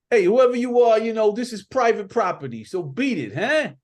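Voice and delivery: high, loud, fast dismissive shout; brush-off. Acted. Chance ordering the stranger off his property, high loud brush-off. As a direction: tell the stranger to beat it. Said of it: "Chance says to you".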